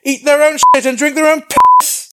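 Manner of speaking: high, full-volume crude insult; loud, hammed-up jeer; shouted screen-drama taunt. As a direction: yell it like a brash commander on screen. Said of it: "if this was a Netflix adaptation".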